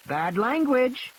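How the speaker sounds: put-on high, slow, prim child's voice; scolding little girl. Lex scolds Ed Regis for swearing, slowly. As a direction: tell him off like a small bossy girl. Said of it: "wagging her finger at him".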